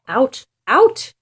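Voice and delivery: high, loud shooing. Acted. Father chasing the dog out of the house, loud shoo. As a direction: chase the dog out.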